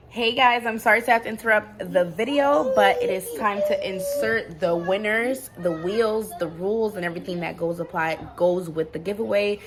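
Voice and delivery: loud, upbeat hostess voice breaking into the video; giveaway host announcement. Acted. Vlogger to viewers, cutting in loudly to announce the giveaway.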